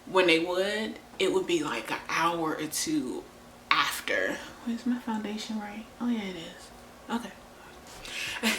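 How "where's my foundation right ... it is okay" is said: unvoiced whisper to herself; whispering aside mid-rant. Real, not acted. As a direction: whisper while searching for makeup. Aside while looking for makeup.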